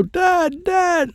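long, high drawn-out cry of "Dad"; high panicked child's call. Acted. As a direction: cry out for your father, stretching the word. Child in danger calls for father.

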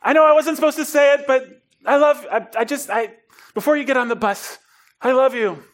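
high, bursting declaration of love; gushing confession. Retold or imitated. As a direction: blurt it out high and overflowing. Repeating the confession at the bus.

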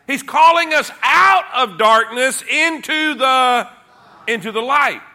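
rising preacher's cadence, driving each line; preaching at full force. Acted. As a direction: hammer the repeated phrase home. Preaching builds.

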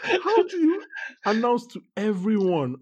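high-pitched, incredulous question. Real, not acted. Host aghast at a funeral confession, incredulous. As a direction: ask it in amused disbelief. Incredulous about a plot point.

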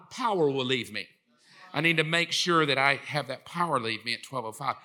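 low, soft deadpan note-to-self; mock-solemn low planning voice; mock agenda reading. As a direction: read it off like a dull calendar entry.

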